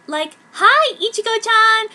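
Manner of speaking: put-on high, loud valley-girl squeal; airhead blonde girl. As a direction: squeal it like a giggling mean girl. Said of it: "said one of them".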